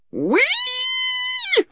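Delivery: very high, drawn-out squeal; long piercing squeal of a captured gnome. A narrator's reading. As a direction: squeal it right at the top of your voice. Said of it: "squealed the Earthman".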